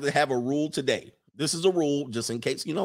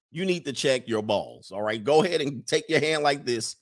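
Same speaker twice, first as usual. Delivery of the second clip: ordinary talk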